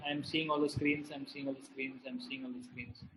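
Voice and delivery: low, increasingly quiet chant to self; fading repetition. Real, not acted. Scanning audience screens, fading repetition.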